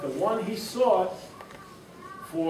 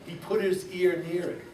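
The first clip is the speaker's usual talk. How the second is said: plain reading voice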